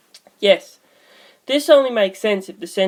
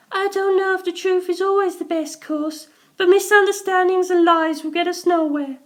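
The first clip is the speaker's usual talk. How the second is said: put-on much higher young woman's voice, gentle; Sugimoto's voice; 13 semitones higher than usual. A narrator's reading.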